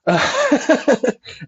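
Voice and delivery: bright laugh up high; sudden high laugh; high burst of laughter. Real, not acted. Laughing at own past outrage.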